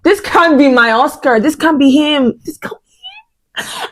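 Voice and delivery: fake-wail in disbelief; hammed-up sob over her driver; mock grief. Mock grief over Oscar's crash.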